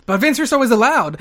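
spring the exception on them; mock heel announcement. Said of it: "And then he goes".

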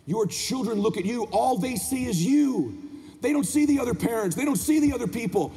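high, driving motivational sermon; motivational preaching. Acted. Speaker challenging parents to be their children's hero, impassioned preaching. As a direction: drive each phrase home like a preacher. Motivational speech at full force.

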